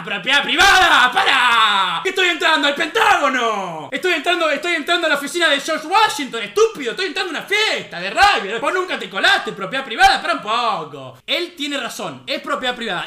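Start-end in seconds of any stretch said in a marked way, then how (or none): 0.0-1.1 loud, drawn-out yell; mock indignant shout. Streamer throwing the claim back, drawn-out mocking shout. 1.1-11.2 loud, incredulous ranting; mock indignation. Streamer mocking the private property claim, loud indignant rant.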